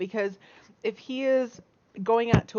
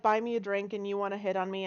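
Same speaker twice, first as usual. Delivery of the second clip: ordinary talk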